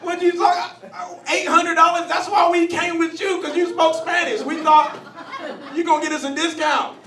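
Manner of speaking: high, very loud outraged protest; indignant yelling. Acted. Friends arguing with Jose over the weed, indignant shouting.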